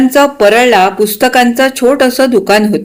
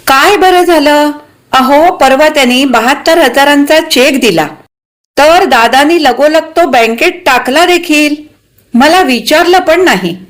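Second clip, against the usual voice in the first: higher-pitched, put-out wife; miffed; 6 semitones higher than usual. A narrator's reading. Wife unconsulted about her husband's deal, miffed. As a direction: complain with hurt at being left out. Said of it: "शकूवहिनी थोड्या हिरमुसल्या झाल्या".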